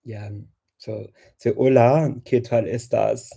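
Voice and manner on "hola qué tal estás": Spanish with a put-on German accent; playful mock German accent in Spanish. Shown as a demonstration. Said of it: "I end up speaking Spanish with a German accent".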